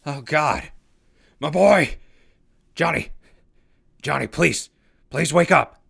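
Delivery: frantic pleading over a still body; begging the unconscious boy to wake up; desperate cries. Acted. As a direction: cry out loud and high for the boy. Finds Johnny seemingly dead.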